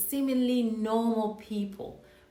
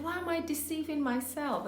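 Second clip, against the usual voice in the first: put-on South African accent; 8 semitones higher, 4 dB quieter than usual. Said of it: "He had this South African accent".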